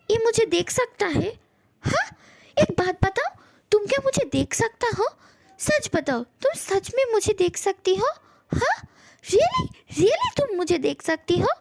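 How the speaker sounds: high-pitched, incredulous questioning; amazed disbelief. Acted. Ghost to the one who can see them, amazed. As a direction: ask in wonder, hardly believing it. Amazed at being seen at last.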